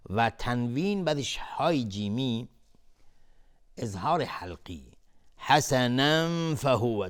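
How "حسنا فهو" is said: slow loud chant, end of حسنا hidden in a nasal hum before فهو; ikhfa. Shown as a demonstration. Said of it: "اخفای حقیقی".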